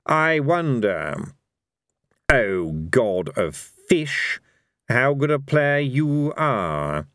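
slow, drawn-out godly voice; lofty, leisurely challenge; Fate, a taunting god. Acted. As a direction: stretch each phrase out, toying with an opponent.

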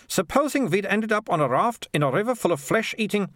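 put-on high-pitched girl voice; Militia. Girl explaining her adventuring bag, high and self-assured. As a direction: launch into a far-fetched tale, pitched high.

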